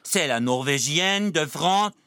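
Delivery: slow put-on French to the ambassador; hammed-up French diplomat address; mock French. Comedian addressing the ambassador in mock French.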